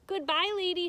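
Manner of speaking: loud, high farewell call; calling goodbye. Acted. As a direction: call it out across the water. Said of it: "she called".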